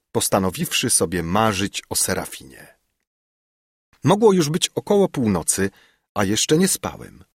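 plain reading voice

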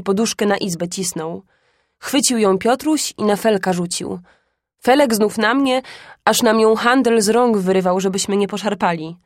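usual speaking voice

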